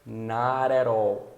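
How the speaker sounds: not at all very drawn out, Ts tapped like R; flapped T, slow. Shown as a demonstration. Teacher demonstrates linking, not at all slow and flapped. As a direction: stretch not at all, linking the words with taps. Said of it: "então fica".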